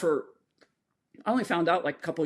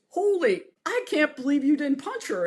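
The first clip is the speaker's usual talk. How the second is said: high-pitched imitation of an astonished trainer; retold amazement; 8 semitones higher than usual. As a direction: exclaim it high, in mock disbelief.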